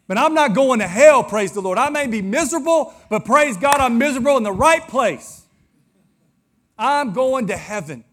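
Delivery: loud, high, ringing preacher's cry; fervent preaching. Acted. Pastor to congregation, loud fervent preaching. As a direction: lift the church with it. Preacher rising to full force.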